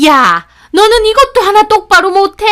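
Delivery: very high, loud angry scolding; shrieking. A narrator's reading. Mother-in-law shrieking at girl who broke a cup. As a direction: shriek it at the culprit. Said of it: "소리를 빽 지르시는".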